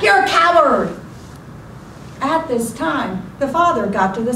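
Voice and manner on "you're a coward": loud, high yell sent back; booming echo. Acted. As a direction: boom the insult back as an echo. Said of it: "The voice boomed back".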